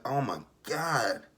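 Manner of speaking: ordinary talk, nothing marked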